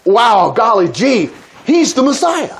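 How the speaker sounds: slow gee-whiz wonder, hammed up; mock naive awe. Preacher mocks shallow Bible reader, hammed-up awe. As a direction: play the wide-eyed reader. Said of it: "do I just read this thinking".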